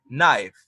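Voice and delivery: "Knife" modelled loud as nife, k silent; model word. Shown as a demonstration. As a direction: repeat "Knife" firmly for the class. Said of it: "Con un cuchillo".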